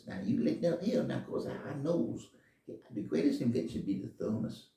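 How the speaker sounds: put-on small boy explaining eagerly; shoe shine boy voice. Shoe shine boy tells the rich men his answer, lightly high.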